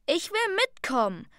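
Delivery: little brother pleading eagerly; eager plea. A narrator's reading. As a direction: beg to come along.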